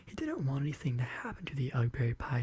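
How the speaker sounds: plain reading voice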